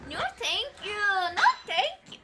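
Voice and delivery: high, swooping "no thank you"; spooked refusal. Real, not acted. Viewer backing away from a haunting video, spooked refusal.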